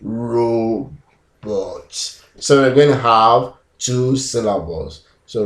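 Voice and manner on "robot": "robot" in two long, level beats, RO... BOT; robot speak. Shown as a demonstration. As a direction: stretch and separate both syllables of "robot". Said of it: "I'm going to be a robot".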